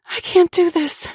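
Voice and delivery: pleading harder the second time; panicked. A narrator's reading. Panic repeated, more intense.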